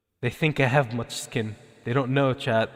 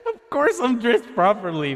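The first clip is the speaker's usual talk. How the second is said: high, incredulous exclamation; amused protest; 8 semitones higher, 3 dB louder than usual. Real, not acted. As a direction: protest in a raised, giggly voice. Laughing protest at dress complaint.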